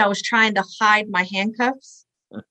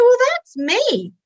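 high, drawn-out puzzled reply; retold surprised realization; 14 semitones higher, 3 dB louder, slower than usual. Woman finding her own name on sheriff's list, acted-out surprise. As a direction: replay the surprise, stretched and high.

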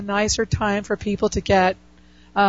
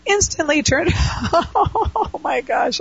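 laughing; 7 semitones higher, slower than usual. Real, not acted. Laughs while reading letter.

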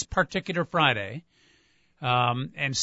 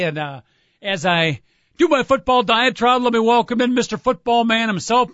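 radio announcer voice; 4 semitones higher, 5 dB louder than usual. Acted. Show intro announcer read.

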